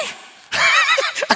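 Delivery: loud, high burst of laughter; big laugh. Real, not acted. Preacher laughs at God's surprising answer, loud burst of laughter. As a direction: laugh out loud, caught off guard.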